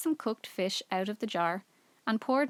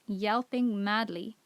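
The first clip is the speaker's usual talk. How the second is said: usual speaking voice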